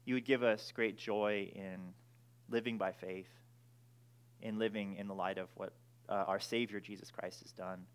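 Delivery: soft, low prayer voice; hushed pastor's prayer. Acted. Closing prayer before sermon.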